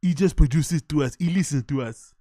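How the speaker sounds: mock near-South African accent; mimicked South African-like accent. Host copying the interviewer's line, mock South African accent. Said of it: "he almost sounds South African".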